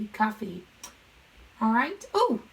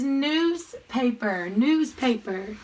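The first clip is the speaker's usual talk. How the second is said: slow clear reading; much slower than usual. Shown as a demonstration. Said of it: "teacher reading word slowly".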